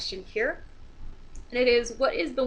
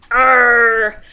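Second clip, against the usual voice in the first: pirate voice; 13 dB louder than usual. Acted. Pirate punchline in joke.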